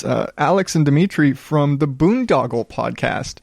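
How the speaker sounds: normal talking voice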